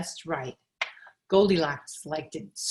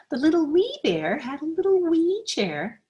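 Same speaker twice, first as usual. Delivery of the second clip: much higher, small and squeaky; tiny squeaky voice; 10 semitones higher, 4 dB louder than usual. A narrator's reading. Reading to a child, little wee bear in a high squeak.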